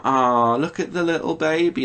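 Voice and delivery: put-on the bus lady character cooing at a baby; lightly voiced lady. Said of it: "the lady was, like, encouraging me".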